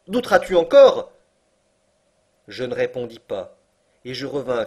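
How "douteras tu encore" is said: high, loud taunting question; triumphant challenge. Acted. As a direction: dare him to doubt it now. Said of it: "s'écria mon oncle". (défi lancé, haut et très fort)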